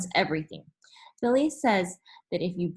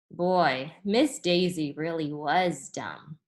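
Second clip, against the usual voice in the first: ordinary delivery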